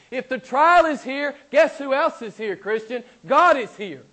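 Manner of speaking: high, loud, punched sermon delivery; full-force preaching. Real, not acted. Preacher urging believers that God is here, full-force preaching. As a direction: preach it hard, driving the key words.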